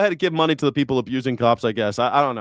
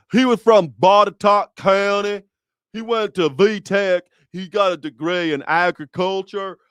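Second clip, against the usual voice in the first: raised, mocking Southern twang; ex-father-in-law's Virginia accent; 5 semitones higher, 5 dB louder than usual. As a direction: put on a thick Virginia accent. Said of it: "that was his accent".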